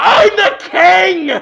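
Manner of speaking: high, loud, drawn-out shout. Acted. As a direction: bellow it right in someone's face. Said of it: "and shout".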